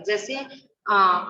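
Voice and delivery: ordinary talk, nothing marked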